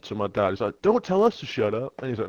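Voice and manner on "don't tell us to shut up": high-pitched quarrelling retort; retold telling-off; mock indignant rebuke. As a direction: act out an affronted officer, voice raised in pitch. Acted quarrel with police.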